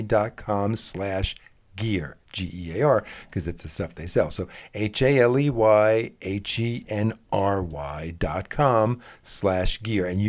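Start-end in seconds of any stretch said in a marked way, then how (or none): 4.7-10.1 slow, letter by letter; slow spelling. Shown as a demonstration. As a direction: spell it out slowly. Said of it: "spelling out web address".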